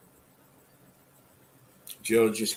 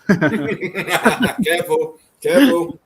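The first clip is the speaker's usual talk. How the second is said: laughing; 8 semitones higher, 9 dB louder, much slower than usual. Real, not acted. Laughing mock warning about slate.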